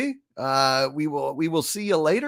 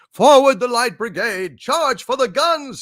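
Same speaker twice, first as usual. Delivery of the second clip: louder, higher battlefield order; commander's call; 8 semitones higher, 8 dB louder than usual. A narrator's reading. Officer ordering the brigade to charge, loud and high. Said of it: "he said".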